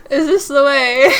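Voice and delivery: loud, drawn-out line breaking into laughter; laughing. Real, not acted. Reader voicing a question on the route, loud and laughing. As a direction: stretch it out while cracking up. Reads line while cracking up.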